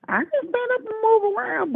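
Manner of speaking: high-pitched indignant protest; retold insistence. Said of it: "And I said".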